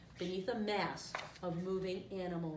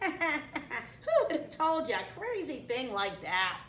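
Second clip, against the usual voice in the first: higher, slow mocking cartoon voice; laughing meerkat; 5 semitones higher, 3 dB louder, slower than usual. A narrator's reading. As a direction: scoff at it with laughter. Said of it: "Pumbaa and Timon laughed".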